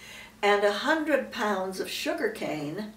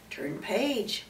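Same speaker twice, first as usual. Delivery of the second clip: ordinary delivery, nothing marked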